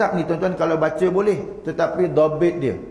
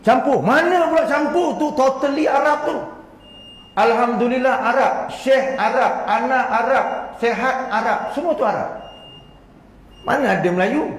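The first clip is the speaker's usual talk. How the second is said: much higher and louder, insisting it is all Arabic; emphatic rebuttal; 10 semitones higher, 3 dB louder than usual. Acted. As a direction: hammer home that every word is Arabic. Rebuts the mixed-language claim. (tegas dan bersemangat, suara tinggi)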